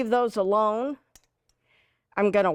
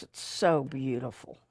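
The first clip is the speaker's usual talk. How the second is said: ordinary delivery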